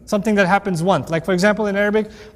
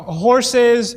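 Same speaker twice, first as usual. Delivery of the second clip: ordinary talk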